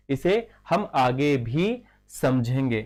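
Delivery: slow, measured restatement; slow repeat. Real, not acted. Teacher repeating a point for students, slowly and deliberately. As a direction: space the words out for students.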